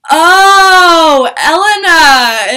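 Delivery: loud, high, drawn-out cry of recognition; excited recognition. Retold or imitated. Singer recognizes her fan, excited loud cry. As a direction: stretch the name out in a delighted yell.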